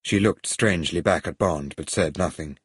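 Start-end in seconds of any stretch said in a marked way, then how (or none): none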